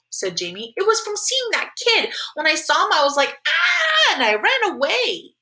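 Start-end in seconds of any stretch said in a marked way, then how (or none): 0.8-2.9 put-on high, insistent little-kid voice; insistent little brother. Little Jamie explains his nightmare to mom, insistent. 2.9-5.4 put-on very high, drawn-out kid's shriek; screaming little brother. Little Jamie tells mom how he ran away, screaming. Child re-enacts his scream.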